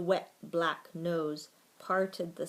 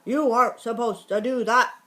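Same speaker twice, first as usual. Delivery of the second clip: loud, fast, higher scolding bark; 5 semitones higher, 10 dB louder, faster than usual. A narrator's reading. Watchdog scolding the mouse who slipped past, barked. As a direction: bark it as an outraged rule-keeper. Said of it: "barked Sam".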